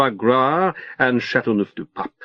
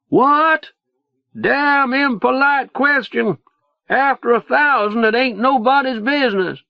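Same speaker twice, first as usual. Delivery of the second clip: screaming; 12 semitones higher than usual. A narrator's reading. Leo yells his answer into the narrator's ear, high shouted retort. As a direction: bellow it over the engine noise. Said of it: "he screams".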